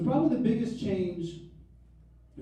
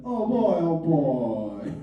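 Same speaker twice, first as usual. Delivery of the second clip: put-on sad oh boy; much slower than usual. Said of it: "I walk back real sad".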